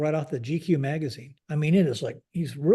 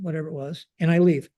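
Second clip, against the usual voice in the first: normal talking voice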